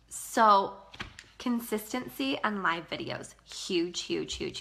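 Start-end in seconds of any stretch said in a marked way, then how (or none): none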